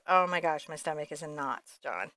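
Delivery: usual speaking voice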